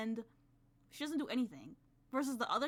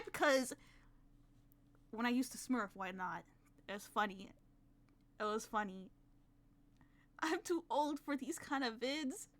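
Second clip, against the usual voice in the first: normal talking voice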